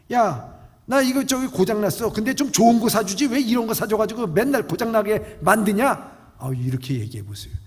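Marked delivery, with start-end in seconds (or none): 0.1-6.3 loud, high grumbling; grumbling father. Acted. Father loudly blames his son for the cheap hearing aid. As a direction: throw the blame back.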